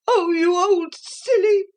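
high, tearful outburst; a mild crying rage; understated angry sobbing voice; angry tears. A narrator's reading. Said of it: "in a burst of angry tears".

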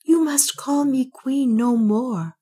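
slow, high, halting voice of shame; a queen humbled, voice cracking; broken with humiliation. Acted. Juana confessing to Ulfan, broken with humiliation. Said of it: "in a voice broken with humiliation".

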